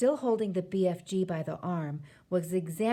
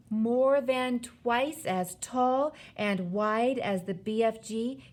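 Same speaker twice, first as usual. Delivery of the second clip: ordinary delivery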